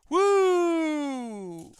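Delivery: long high "woo"; high-pitched drawn-out holler; excited whoop. Real, not acted. Exclaims mid-description of wild film.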